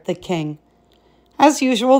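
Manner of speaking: ordinary delivery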